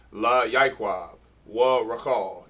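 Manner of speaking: La Yaiquab, Wa Rakal slow, each particle set apart; slow demo with a gap after La and Wa; spaced out. Shown as a demonstration. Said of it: "emphasize that space there".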